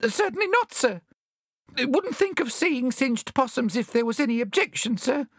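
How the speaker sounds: high, timid squeaky babble; frightened, servile bandicoot voice; quavering. A narrator's reading. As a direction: deny it meekly in a quaver. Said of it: "quavered the bandicoot".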